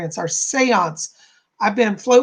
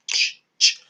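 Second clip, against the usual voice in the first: mouth hi-hat. Pretend. Jamming, mouth percussion.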